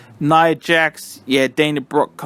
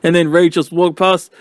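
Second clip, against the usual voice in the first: ordinary talk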